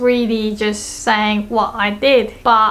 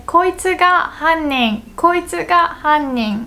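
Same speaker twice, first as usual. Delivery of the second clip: higher, pointed accusation, koitsu ga hannin; accusing; 7 semitones higher than usual. Shown as a demonstration. Viewer naming the suspect of a crime drama, higher and accusing. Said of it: "if I think someone is suspicious".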